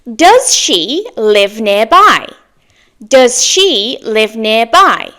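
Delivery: each word spaced out slowly; slow clear model. Shown as a demonstration. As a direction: say "Does she live nearby?" slowly and clearly for learners. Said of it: "Next sentence".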